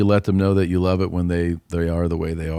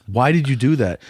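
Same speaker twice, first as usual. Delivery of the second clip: exasperated; 6 semitones higher, 3 dB louder than usual. Retold or imitated. Mom questioning kid.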